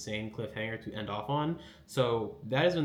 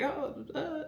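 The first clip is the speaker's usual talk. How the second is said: much higher, flustered stammer; stuttery nervous. Retold or imitated. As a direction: caught out and stumbling. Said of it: "it gets all stuttery".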